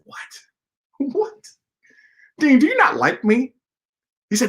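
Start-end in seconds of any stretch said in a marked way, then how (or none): 0.0-1.1 shocked whisper; whispered what. Retold or imitated. Shocked at question. 1.1-3.5 high, incredulous; student stung by the dean's question; offended surprise. Retold or imitated.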